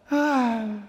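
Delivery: long swooning sigh; acted dreamy exhale; lovesick sigh. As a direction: sigh as if faint with love. Sighs after "faint with love.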